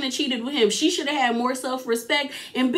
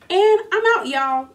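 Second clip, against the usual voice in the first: high, loud bright send-off; loud cheerful farewell to viewers; upbeat sign-off; 8 semitones higher, 3 dB louder than usual. Real, not acted. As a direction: sign off with a burst of energy. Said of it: "I didn't say it".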